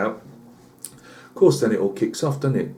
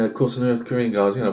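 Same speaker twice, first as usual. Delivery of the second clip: usual speaking voice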